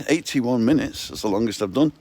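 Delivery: usual speaking voice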